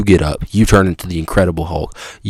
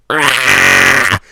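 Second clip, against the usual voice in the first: cave creature roar. Acted. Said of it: "and he goes".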